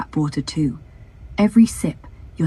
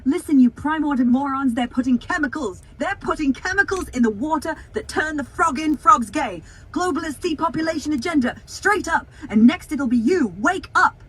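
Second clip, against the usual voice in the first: lightly put-on ranting conspiracy host, pitched higher; higher-pitched mock conspiracy tirade; Alex Jones; 6 semitones higher than usual. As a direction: rant like an alarmist radio host, voice raised in pitch. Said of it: "Can you do an impression of Alex Jones".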